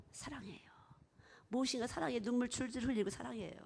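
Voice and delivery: whispered, tender love confession; tearful whisper. Retold or imitated. Preacher voicing a tearful believer, whispered.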